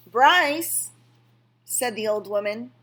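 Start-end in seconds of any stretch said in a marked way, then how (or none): 0.1-0.9 high, loud call of a name. A narrator's reading. Old woman calls the boy away, loud and high.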